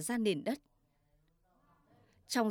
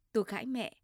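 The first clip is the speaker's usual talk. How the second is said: ordinary delivery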